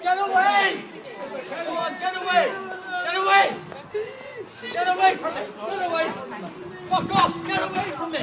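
screaming get away at them; frantic furious screaming. Real, not acted. Man shouting at people removing him.

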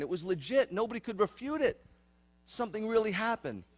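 triumphant declaration; fervent exclamation. Real, not acted. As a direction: raise it up like a testimony. Preacher testifying with fervor.

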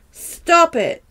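loud high shout; shouted stop. Acted. Girl screaming at the intruder to stop, loud shout. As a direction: yell it to make them stop.

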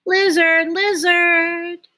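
plain reading voice

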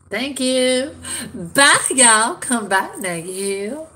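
very high, loud sing-out farewell; playfully crazy. Pretend. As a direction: call out a goofy, over-the-top goodbye. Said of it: "I had to leave a little craziness in there".

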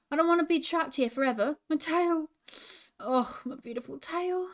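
a touch of anguish over the trapped tail; mild distressed cry. A narrator's reading. Roo tells Hooter about the trapped tail, mildly distressed. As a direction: lament it in character, not full tears. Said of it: "he cried".

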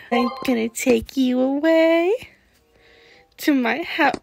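ordinary delivery

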